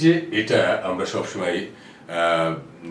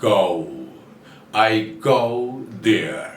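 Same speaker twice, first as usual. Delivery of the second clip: normal talking voice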